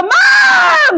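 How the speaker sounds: high, loud startled cry of ma'am; a staffer's sudden alarmed call; alarmed shout. Retold or imitated. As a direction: yell it as the staff see her fall. Staff see her fall.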